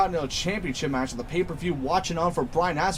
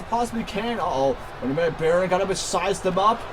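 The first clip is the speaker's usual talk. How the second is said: ordinary talk, nothing marked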